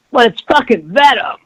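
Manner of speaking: high-pitched fanboy protest; mock angry nerd. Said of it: "anger and the hatred that nerds will spew".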